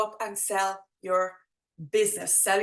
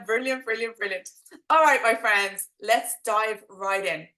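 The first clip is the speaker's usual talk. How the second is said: ordinary talk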